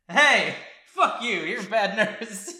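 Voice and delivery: high, slow, loud mock outburst; mock Natalie yell. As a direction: drag out each word in a shrill-free high yell. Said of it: "Natalie's like".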